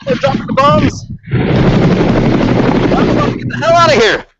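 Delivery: high, frantic war correspondent; war zone reporter. Pretend. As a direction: report breathlessly from under bombs. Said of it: "Give me a war broadcast".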